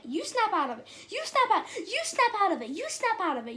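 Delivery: hammed-up hysterical back-and-forth; frantic scolding. Frantic back-and-forth snapping out of panic.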